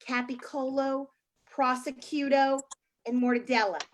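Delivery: capicolo, prosciutto, mortadella said the flat American way; slow Americanized prosciutto; American pronunciation. Shown as a demonstration. Comic translating Italian deli meats into American pronunciation. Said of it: "American translation".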